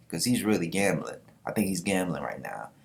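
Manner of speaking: ordinary talk, nothing marked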